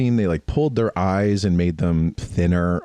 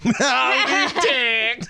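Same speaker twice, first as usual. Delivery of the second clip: laughing cowboy howdy; much slower than usual. Retold or imitated. Echoing the cowboy greeting, laughing.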